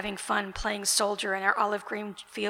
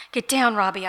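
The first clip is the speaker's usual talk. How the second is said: loud shouted warning; scream; 7 dB louder than usual. Acted. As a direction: yell it to get him down fast. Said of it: "I screamed".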